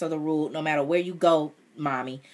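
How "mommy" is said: ordinary delivery, nothing marked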